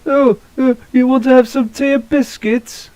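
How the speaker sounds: very high, fluting posh English caricature; mock posh British. Showing a friend the impression Americans do, mock posh British. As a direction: send up a prim, posh British accent. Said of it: "That's the voice that they put on".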